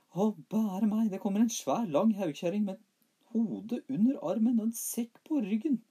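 put-on very high, loud frightened child; high squeaky boy voice. A narrator's reading. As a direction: squeal it like a scared little boy. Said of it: "sa mor hans".

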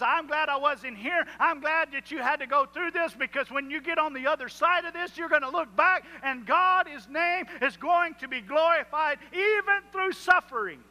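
soaring sermon delivery; full-force preaching. Acted. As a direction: preach it with rising fire. Preaching Jesus' words at full force.

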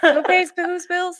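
high, slow laughing question. Real, not acted. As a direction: laugh through the words. Laughing at the joke.

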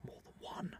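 secretive whisper, barely audible; hushed conspiratorial whisper; whispered spoiler. Real, not acted. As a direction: breathe it so nobody hears the spoiler.

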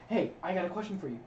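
ordinary talk

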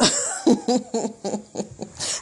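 big burst of laughter; loud laugh. Real, not acted. Laughing at her own singing, loud burst.

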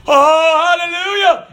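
a hammed-up jolt of fear; mock panic. Priest wakes near a cliff, mock panic.

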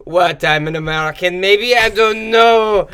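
loud, high, playing dumb; clueless. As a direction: shrug it off, drawn out at the end. Clueless stoned American quote.

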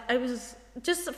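normal talking voice

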